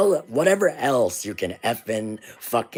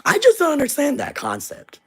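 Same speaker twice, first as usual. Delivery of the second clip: much higher, raised voice; exasperated disbelief; 3 dB louder than usual. Real, not acted. Vlogger venting at voters, high exasperated disbelief. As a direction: cry it out in disbelief.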